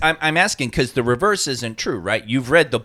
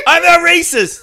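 high, loud comic outcry; mock protest; 12 semitones higher, 8 dB louder than usual. Comic denying racism after accent bit, high loud protest. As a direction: yelp it out in mock defensiveness.

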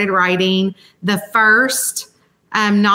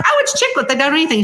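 high-pitched insistent protest, imitating herself; mock-indignant retort; 7 semitones higher, much faster than usual. To the author doubting her genre, high mock protest. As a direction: insist it's chick lit, voice jumping up. Retelling arguing with fellow author.

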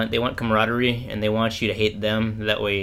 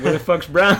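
yelling; 7 semitones higher, 7 dB louder than usual. Retold or imitated. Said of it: "So they're like".

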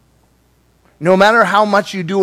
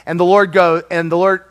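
ordinary talk

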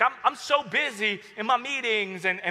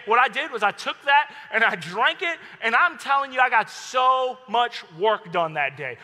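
louder, near-shouting with excitement; excited; 4 semitones higher, 4 dB louder than usual. Real, not acted. Preacher to congregation, raving about the sugary drink, shouting excitedly. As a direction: boast about the sugar-fuelled workday.

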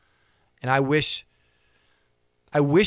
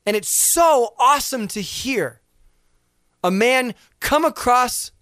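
raised, higher preacher voice building conviction; full-force sermon delivery; fiery preaching; 9 semitones higher, 4 dB louder than usual. Acted. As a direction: preach it out to the congregation. Impassioned preaching stretch.

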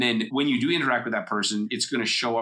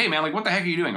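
mock indignant, pitched up; defensive, angry; 7 semitones higher, faster than usual. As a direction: act out a mildly angry challenge in a higher voice. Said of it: "in a defensive, angry tone".

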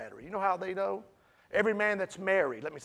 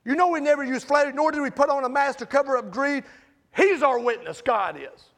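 loud, raised-pitch pulpit delivery; forceful preaching; 5 semitones higher, 9 dB louder than usual. Real, not acted.

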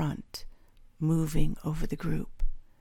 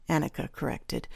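ordinary delivery, nothing marked

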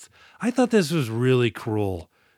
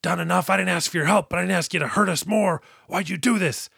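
high, raised cry at the heavens; mock yelling at God; 6 semitones higher than usual. Hackman hanging from the valve and crying out at God, higher. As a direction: hurl it upward at God, a retold yell. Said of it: "And he is yelling".

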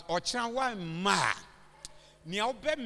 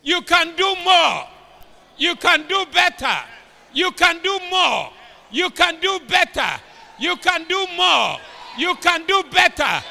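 preaching shout; 8 semitones higher, 12 dB louder than usual. Real, not acted. Preacher exhorting the congregation.

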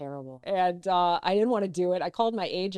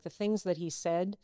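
ordinary talk, nothing marked

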